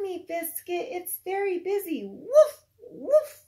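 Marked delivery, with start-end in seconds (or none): 2.1-3.4 loud, high playful woofs; dog barks. Acted. Teacher barking for Biscuit the puppy, loud and playful. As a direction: bark like a happy puppy.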